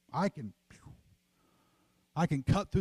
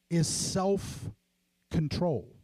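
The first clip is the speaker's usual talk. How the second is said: slow emphasis; much slower than usual. Shown as a demonstration. Reading scripture slowly.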